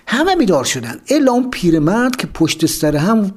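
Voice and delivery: usual speaking voice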